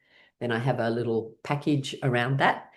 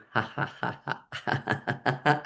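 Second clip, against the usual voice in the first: soft breathy laugh; 6 dB quieter than usual. Real, not acted. Laughing tree roots exercise.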